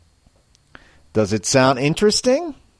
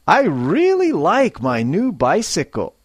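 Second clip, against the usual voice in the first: higher, with feeling on really; lively and pleased; 4 semitones higher than usual. Shown as a demonstration. Teacher modelling for students, bright and lively. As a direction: sound happy about the bike. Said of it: "a little more feeling and life".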